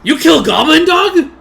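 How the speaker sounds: outraged disbelief at the dog killing; shocked goblin. Acted. Goblin outraged that they killed goblin dogs, high loud shock.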